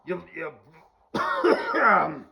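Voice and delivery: a loud put-on Scot mocking the Irish; mock Scottish accent. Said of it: "whole Scottish thing".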